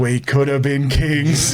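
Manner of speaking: slow, stretched-out punchline repeat; slow, hammed-up wistfulness; drawn-out mock lament. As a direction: draw it out for comic regret. Repeats cousin's line for comic effect.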